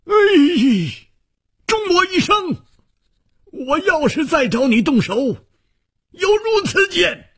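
high, anguished cry of an oath; long howl to heaven. Acted. Defeated swordsman swearing on his broken sword, howling high. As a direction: cry it out very high, drawing out the endings. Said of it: "仰天长啸".

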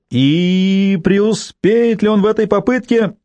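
high, slow announcer voice; radio sports commentator. Acted. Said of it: "произнёс голосом спортивного комментатора на радио".

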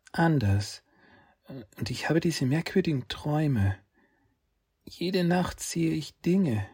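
put-on a small boy confessing his strange dreams; young boy voice. Saki's voice, the little brother.

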